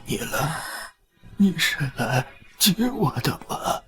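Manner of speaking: low, faint tearful murmur; weak tearful longing. Acted. Old son asking dead mother to fetch him, tearful.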